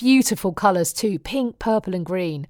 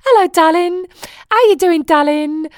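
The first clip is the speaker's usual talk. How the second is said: put-on high, loud Essex accent, "Dallin" for darling; chirpy Essex greeting, drop the r in "Dallin"; Essex mum greeting; 9 semitones higher, 6 dB louder than usual. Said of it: "She was from Essex".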